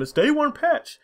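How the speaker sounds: high, patronizing sing-song; mocking the publisher excusing downloads, high and patronizing; mock corporate reassurance. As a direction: soothe gamers like a condescending PR rep. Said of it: "using that safe term".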